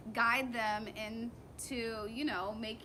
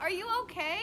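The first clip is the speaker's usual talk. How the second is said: caring check-in; 8 semitones higher, faster than usual. Retold or imitated. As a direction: ask it gently worried. Checking on fallen child.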